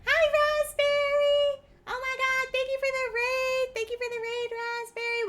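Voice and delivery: high, bright sing-song streamer greeting; cute anime streamer. Acted. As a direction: squeal a welcome to the raiders.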